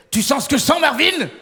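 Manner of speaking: Joe Pesci. Acted. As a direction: act the small burglar questioning his partner. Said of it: "qui est joué par Joe Pesci".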